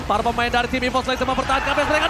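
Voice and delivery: usual speaking voice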